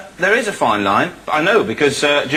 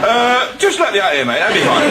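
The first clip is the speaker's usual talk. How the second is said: mock nervous; 6 semitones higher than usual. Scared hitchhiker wants out.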